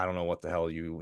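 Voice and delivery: ordinary talk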